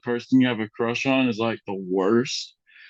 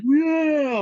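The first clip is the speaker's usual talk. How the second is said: high whooping yeah; cheering yeah. Retold or imitated. Crowd cheering his bike jump, high whoop. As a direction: cheer him on as he jumps.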